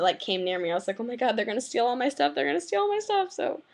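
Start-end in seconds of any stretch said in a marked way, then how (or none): 2.3-3.3 higher, hammed-up fearful repeat; mock panic about thieves; mock paranoid whine. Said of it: "freaking out everyone".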